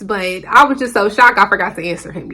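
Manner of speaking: ordinary talk, nothing marked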